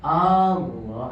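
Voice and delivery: "Allah" with its first A held long; drawn-out opening on "Allah"; lengthened A. Retold or imitated. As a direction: imitate the imam stretching the A of "Allah". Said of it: "hanya panjang".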